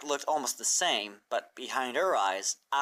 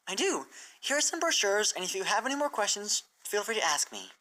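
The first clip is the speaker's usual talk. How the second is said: raised, earnest teacher pony explaining; bright scholarly mare, raised pitch; Twilight's voice; 8 semitones higher than usual. Acted. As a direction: lay out the plan to her hopeful and clear. Twilight hands over brochures.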